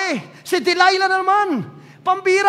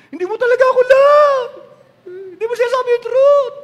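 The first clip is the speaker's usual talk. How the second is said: high, loud whining reproach; hammed-up wounded girlfriend; sulky Delilah; 8 semitones higher, 5 dB louder than usual. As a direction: pout it out as a hurt lover. Voicing Delilah complaining.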